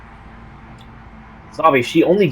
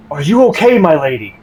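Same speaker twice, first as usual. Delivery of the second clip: concerned servant; 6 semitones higher, 6 dB louder than usual. Acted. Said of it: "and he says".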